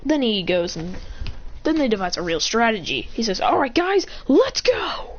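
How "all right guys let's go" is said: higher-pitched rallying cry; rally the troops with a lifted voice; rallying call. Acted. Leader sending the guys off, rallying call.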